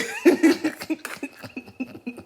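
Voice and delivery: a burst of high laughter; high giggle. Real, not acted. Vlogger cracking up at her own shade, high giggle.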